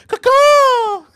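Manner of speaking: piercing high, loud caw; very loud, high mock screech; imitated creature call. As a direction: shriek it like a movie creature. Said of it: "the creature makes a noise".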